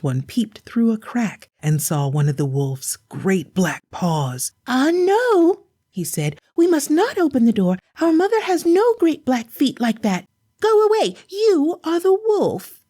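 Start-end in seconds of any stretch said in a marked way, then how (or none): 4.7-5.7 put-on high children's voice, refusing; tiny chicks standing firm; defiant little goslings. 6.6-12.8 put-on high children's voice, refusing; tiny chicks standing firm; defiant little goslings.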